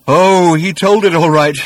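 loud, slow savage outburst. A narrator's reading. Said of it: "said the man savagely".

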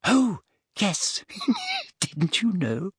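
put-on high, affected girlish giggle; giggly. A narrator's reading. Hermione confirming the romance to Parvati, giggling. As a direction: pitch it up and giggle coyly mid-line. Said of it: "with the most un-Hermione-ish giggle".

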